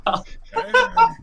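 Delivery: high, loud laughing. Real, not acted. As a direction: squeal it through laughter. GM laughs at flexing giant.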